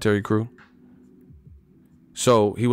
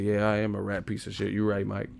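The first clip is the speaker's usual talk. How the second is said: softer, defeated reply; meek, cowed agreement; 3 semitones lower, 7 dB quieter than usual. Retold or imitated. Comic plays the cowed commentator answering Tyson, meekly. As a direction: mumble it like you're backing down. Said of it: "You're like".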